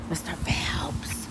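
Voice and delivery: breathy whisper of the dog's name, slow; whispered call. Real, not acted. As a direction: whisper his name like a warning. Calling dog mid-scolding.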